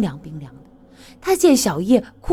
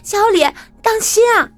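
much higher, louder shout; loud warning cry; 13 semitones higher, 4 dB louder, faster than usual. Acted. Girl warns her boyfriend of the attack, loud high cry. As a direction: call out in alarm across the fight. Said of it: "小叶高声地叫道".

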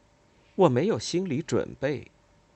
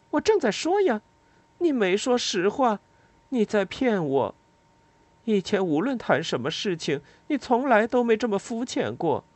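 higher, pushing voice, accusing; insistent accusation; 11 semitones higher than usual. Acted. The narrator accuses Lila of being shallow, insistent. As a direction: press her: you're lying to me.

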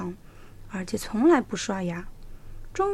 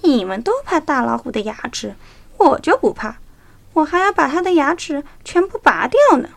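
boastful fox; 4 semitones higher, 7 dB louder than usual. A narrator's reading. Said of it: "可小狐狸说".

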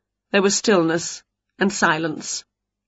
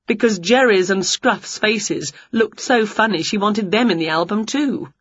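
ordinary delivery

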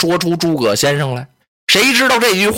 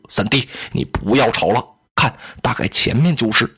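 lower, courteous elder-brother voice, gently chiding; a measured, polite sworn elder brother; Liu Bei in character; 8 semitones lower than usual. Acted. Liu Bei urging his brothers to walk in, lower and courteous.

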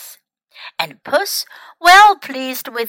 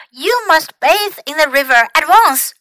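excited cry; 5 semitones higher, 5 dB louder than usual. A narrator's reading. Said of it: "he cried".

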